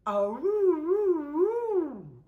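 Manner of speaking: long loud high howl; dog howl. Acted. George howls at the new stray dog, loud. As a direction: howl it out slow and loud like a hound. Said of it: "cried George".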